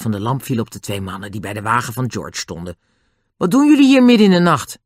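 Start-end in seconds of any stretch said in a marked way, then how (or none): 3.4-4.8 raised, high, fast demand; indignant challenge. A narrator's reading. Julian confronts two men outside at night, loud and indignant. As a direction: call them out loudly.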